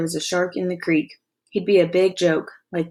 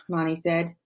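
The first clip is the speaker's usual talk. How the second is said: usual speaking voice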